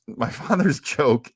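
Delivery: normal talking voice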